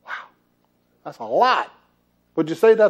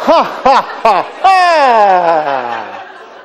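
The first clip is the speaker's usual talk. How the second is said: loud, deliberate ha-ha-ha; big showy laugh on demand; demonstrated laugh; 7 dB louder, much slower than usual. Shown as a demonstration. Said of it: "Just laugh".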